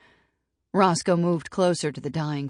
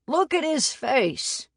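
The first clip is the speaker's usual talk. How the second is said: high and slow, a mocking cackle in the voice; much higher, drawn out, taunting; cackling; 12 semitones higher, 4 dB louder, slower than usual. A narrator's reading. Roscoe cackling at the scared boy by the campfire, high and mocking. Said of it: "Roscoe cackled".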